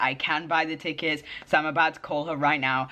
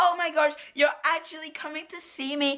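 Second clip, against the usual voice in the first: high squealing girl imitation; excited squeal; 12 semitones higher than usual. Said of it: "Hopefully she's gonna be like".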